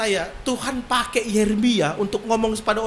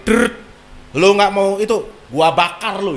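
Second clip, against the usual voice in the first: loud, hot threat of fire; fiery threat; 2 semitones lower, 5 dB louder than usual. Retold or imitated. Preacher imitating Elia threatening the people, loud fiery threat. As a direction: thunder it like a fire prophet.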